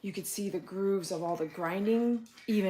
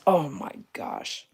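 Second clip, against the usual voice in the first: ordinary talk, nothing marked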